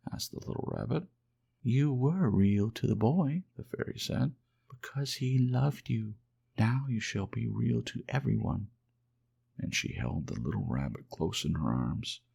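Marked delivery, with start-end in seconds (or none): 1.6-3.4 gentle fairy voice. Acted. Fairy comforting the lonely toy rabbit, high and gentle. 4.7-8.7 gentle fairy voice. Acted. Fairy comforting the lonely toy rabbit, high and gentle.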